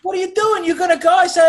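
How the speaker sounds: high, loud frantic bickering; exasperated hammed-up protest; mock quarrel. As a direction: imitate lovers yelling over each other. Mock lovers' quarrel in retold scene.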